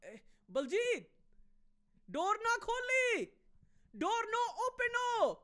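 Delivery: put-on high, loud Punjabi uncle accent. Neighbour warning Baljit not to open the door, loud high Punjabi accent. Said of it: "and be like".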